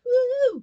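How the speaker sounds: high imitated "woo" cheer; crowd whoop. English women answering the comedian, high whoop. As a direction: whoop like a woman in the audience. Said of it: "any English women in the crowd".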